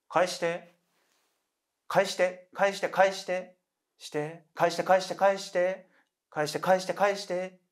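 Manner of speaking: high, monotone, insistent chant; ghostly repeated demand. Acted. Women surround the sleeper in a nightmare, high relentless chanting.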